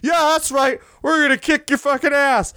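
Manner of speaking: squeaky mock tough guy; mock tough boast. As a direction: ham up a cocky wrestler. Voicing the Outlaws boasting.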